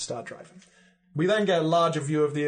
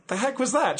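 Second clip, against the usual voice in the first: startled exclamation; 10 semitones higher, 5 dB louder than usual. Acted. Van Helsing startled.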